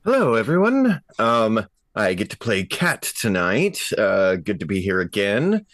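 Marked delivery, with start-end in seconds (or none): none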